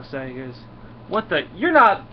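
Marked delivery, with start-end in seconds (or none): 1.1-2.0 high, loud mock disbelief; hammed-up shock, rising sharply; startled realization. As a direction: act out being caught off guard. Hero realizes team are impostors.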